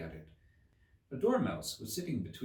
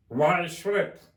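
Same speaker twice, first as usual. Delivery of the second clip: muttered; 9 semitones higher, 10 dB louder, slower than usual. A narrator's reading. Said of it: "muttered the Hatter".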